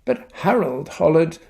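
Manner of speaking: lively storybook voice with character voices; reading to children. A narrator's reading. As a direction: read it like bedtime story time for kids. Reads picture book aloud.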